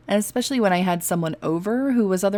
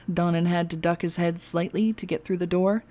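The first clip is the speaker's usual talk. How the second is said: usual speaking voice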